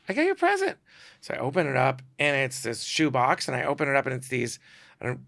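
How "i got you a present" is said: a lightly voiced young woman; sweet girlish voice. Handing him the gift, a very high girlish voice. Girl giving him a present.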